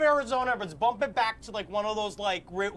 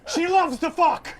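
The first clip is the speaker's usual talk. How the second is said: high, loud and slow desperate shout; hammed-up slow frantic plea; frantic yell; 9 semitones higher, 10 dB louder, slower than usual. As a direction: sell her out at full volume. Desperate victim pleading bit.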